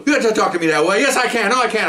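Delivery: high-pitched indignant back-talk; retold heated quarrel. Training officer arguing with the sergeant, high mock quarrel.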